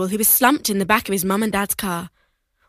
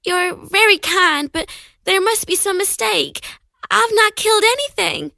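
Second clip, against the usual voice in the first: hesitant; 8 semitones higher than usual. Acted. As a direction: speak like a timid young girl. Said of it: "with hesitation".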